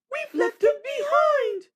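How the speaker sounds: very high, loud, slow wail; two coaches sobbing together. A narrator's reading. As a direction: wail it out in distress. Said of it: "sobbed Annie and Clarabelle together".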